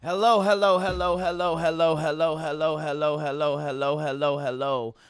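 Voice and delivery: big opening hellos, winding down; hype podcast intro. Acted. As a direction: call out the show open like a host.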